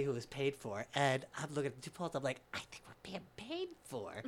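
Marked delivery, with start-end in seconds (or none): 2.5-4.2 high, very soft, thrilled confiding; hushed aside. Retold or imitated. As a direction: squeak it secretly to your two friends. Aside to his two friends.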